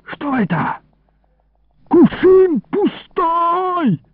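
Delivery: high, drawn-out outraged wail; roaring. A narrator's reading. Thief finds the wine jug empty, roaring. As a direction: cry out high, stretching the words. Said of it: "и вдруг зарычал".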